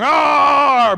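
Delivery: hammed-up roar; mock monster growl. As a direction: growl like a dad playing monster. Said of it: "running laps going".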